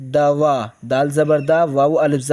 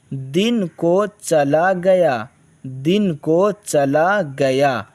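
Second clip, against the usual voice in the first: slow dictation; slower than usual. Shown as a demonstration. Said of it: "teacher reads slowly".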